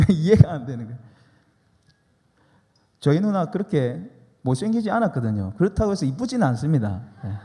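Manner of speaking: ordinary talk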